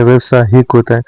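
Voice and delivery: ordinary delivery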